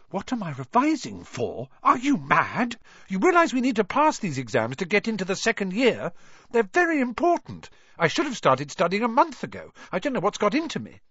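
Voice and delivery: high, exasperated schoolgirl voice; indignant Hermione. A narrator's reading. Hermione outraged about exams.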